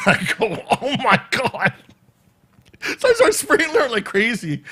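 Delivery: cracking up mid-story, pitch jumping up; can't stop laughing at your own story; laughing while telling. Real, not acted. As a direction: talk through helpless laughter.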